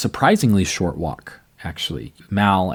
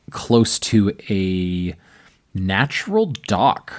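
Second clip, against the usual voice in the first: normal talking voice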